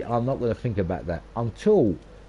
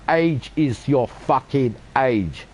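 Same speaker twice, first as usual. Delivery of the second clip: each word slowed and hammered; slow emphatic rant; 3 semitones higher, much slower than usual. Real, not acted. Vlogger hammers his point home to viewers, slow and emphatic. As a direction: lay it down word by word.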